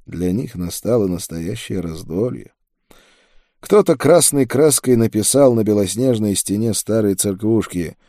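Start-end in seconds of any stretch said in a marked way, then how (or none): none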